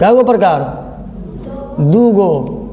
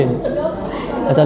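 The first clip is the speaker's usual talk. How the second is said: laughing; 8 semitones lower, 7 dB quieter, much slower than usual. Real, not acted. Reacting with a laugh.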